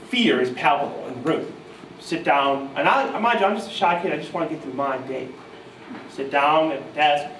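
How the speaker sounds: ordinary delivery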